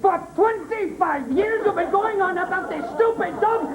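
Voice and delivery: high, fast exasperated rant; exasperated outburst. Acted. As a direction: blow up at her in disbelief. Husband furious at wife.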